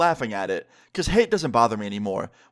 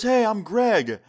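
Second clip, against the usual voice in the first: lightly voiced old man, higher and louder; a hint of a chummy old captain, pitched up; old boyfriend's greeting; 9 semitones higher, 4 dB louder, much slower than usual. Greg introduces himself, voiced.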